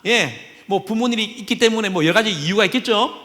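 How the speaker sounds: ordinary talk, nothing marked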